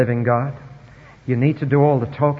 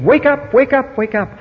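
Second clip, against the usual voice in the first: high, louder mocking cry to an idol; mock shouting; 11 semitones higher, 5 dB louder than usual. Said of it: "they shouted to the image".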